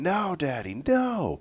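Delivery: put-on high whiny child's cry of no; mock little girl wail. Father retelling daughter's car seat refusal, high childlike wail.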